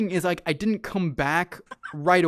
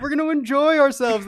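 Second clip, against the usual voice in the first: sarcastic parroting in a raised voice; mock mimicking friends, higher and louder; 8 semitones higher, 4 dB louder than usual. On the coaster, mockingly parroting his friends' cheerful promise. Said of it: "they said".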